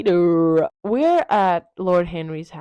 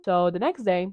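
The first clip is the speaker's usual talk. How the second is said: usual speaking voice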